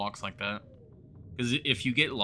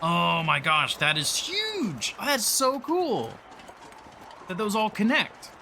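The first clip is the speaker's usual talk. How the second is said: thrilled; 9 semitones higher than usual. Real, not acted. Thrilled by huge connected map.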